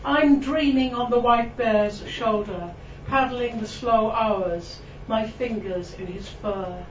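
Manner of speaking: ordinary delivery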